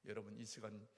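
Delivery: imitating a preacher in full flow; preaching. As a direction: preach it to the congregation, rising. Quoting the revival preacher's message.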